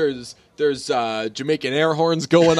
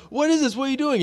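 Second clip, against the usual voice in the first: high indignant cry; outraged Robert; 11 semitones higher, faster than usual. Retold or imitated. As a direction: demand answers, shocked. Robert confronting again.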